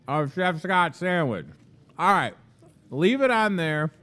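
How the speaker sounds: chewing while talking; mouth full. Real, not acted. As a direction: speak around a big bite. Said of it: "as I take another bite".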